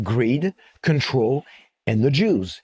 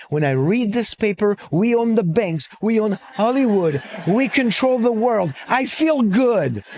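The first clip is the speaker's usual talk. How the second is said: acted boastful list, pitched high; building elation; 7 semitones higher than usual. Joke's punchline, friend proudly builds up.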